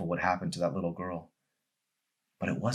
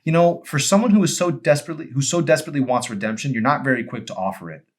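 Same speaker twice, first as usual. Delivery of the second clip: put-on higher, louder, exasperated young woman; a young woman's indignant, raised reproach; Emery's exasperated voice; 8 semitones higher, 8 dB louder than usual. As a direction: scold him with sharp frustration. Emery chides Arson.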